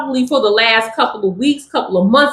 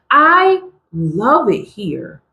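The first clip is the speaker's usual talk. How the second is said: ordinary talk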